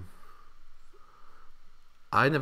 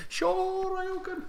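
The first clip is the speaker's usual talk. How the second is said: Street Fighter voice; much slower than usual. Retold or imitated. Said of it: "You make great Street Fighter characters".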